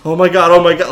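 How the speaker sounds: flustered mock panic; acted fluster. Food runner singled out before coworkers, flustered.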